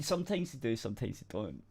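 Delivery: ordinary talk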